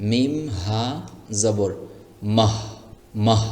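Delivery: slow, separated spelling with মাহ ending in a short free breath; correct soft h. Shown as a demonstration. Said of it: "আপনাকে বলতে হবে এভাবে".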